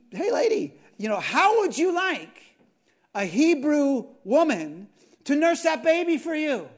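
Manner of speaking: high, loud, brash Hey, lady pitch; a bold kid hailing a princess; cheeky big sister. Retold or imitated. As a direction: sister calling out boldly to Pharaoh's daughter.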